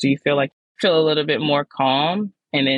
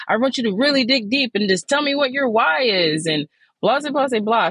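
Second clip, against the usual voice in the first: mock coach; 8 semitones higher than usual. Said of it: "that's what they were always saying".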